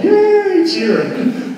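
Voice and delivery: high mock celebration; a very high, sarcastic yay; sarcastic cheer. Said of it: "You'd be like".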